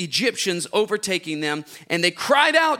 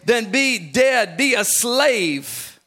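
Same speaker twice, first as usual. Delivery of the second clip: ordinary talk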